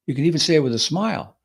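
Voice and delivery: ordinary talk, nothing marked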